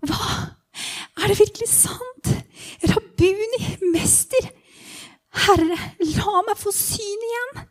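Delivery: high-pitched, breathless disbelief; overwhelmed, hopeful plea; astonished pleading. Acted. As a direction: plead in awe, voice lifted high. Beggar answers Jesus, astonished pleading.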